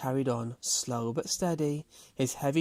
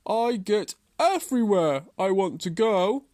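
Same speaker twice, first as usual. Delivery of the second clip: loud, raised, indignant tortoise voice; cross tortoise; 7 semitones higher, 6 dB louder than usual. A narrator's reading. Tortoise, fed up with Hare, answers cross and loud. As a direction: stand up for yourself, loud and firm. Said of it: "was cross".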